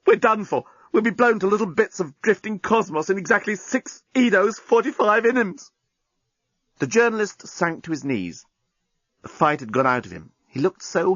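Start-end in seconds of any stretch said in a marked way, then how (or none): 0.0-5.7 despairing. Acted. Journalist despairing at doom.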